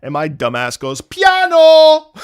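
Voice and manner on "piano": very high, loud belted answer; eager blurted shout. Retold or imitated. Student answers diction teacher, hammed-up high eager shout. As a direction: blurt it out at full voice, hugely overconfident.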